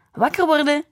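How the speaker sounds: mother calling her son awake, loud and high. A narrator's reading. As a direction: call out to wake him from behind. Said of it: "riep ze tegen mijn rug".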